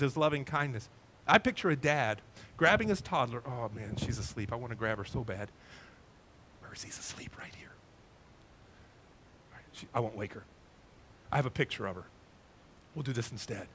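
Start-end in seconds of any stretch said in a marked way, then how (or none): 3.5-5.5 hushed. Real, not acted. Sleeping baby nearby, hushed. 6.6-7.7 whisper. Real, not acted. Whispering so baby stays asleep. 9.5-13.7 hushed. Real, not acted. Baby asleep nearby, hushed.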